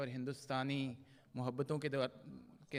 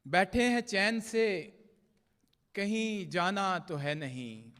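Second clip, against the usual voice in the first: measured, projected mushaira verse with drawn-out line endings; poetry recitation; 5 semitones higher, 9 dB louder, slower than usual. Acted. Poet reciting love couplets to a mushaira audience, declaimed Urdu verse. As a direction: recite the ghazal line by line, pausing between phrases.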